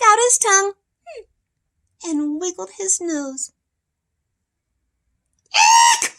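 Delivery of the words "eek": very high, loud eek; frightened shriek. Acted. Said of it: "shouted the elephant".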